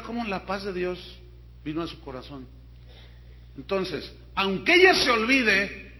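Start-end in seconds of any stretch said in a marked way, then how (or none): 4.3-5.9 loud scripture proclamation; emphatic declamation. Acted. Preacher closing the story with God's promise, loud and emphatic. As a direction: ring the verse out forcefully.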